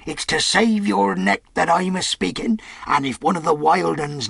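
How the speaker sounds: put-on rough old pirate, earnest and coaxing; old sea cook pleading with a shipmate. Silver pleading with Tom.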